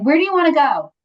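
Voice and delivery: sharp, loud and high mock challenge; aggressive retort. Friend snapping at the laboring mother, mock aggressive. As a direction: imitate the friend snapping back at her. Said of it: "kind of aggressively".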